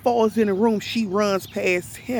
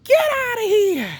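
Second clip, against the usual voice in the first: mock scream; 12 semitones higher than usual. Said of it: "kept on screaming".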